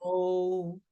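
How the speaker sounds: very slow, low drawn-out letters; slow recital. Pretend. Contestant reciting the alphabet backwards, slow and low. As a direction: stretch each letter out in a low monotone.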